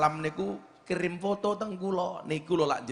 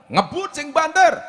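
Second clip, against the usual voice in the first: much higher and louder, slow, punching the speed; excited shout; 13 semitones higher, 11 dB louder than usual. Retold or imitated. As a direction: shout it like flooring the pedal. Retold racing to Kendal.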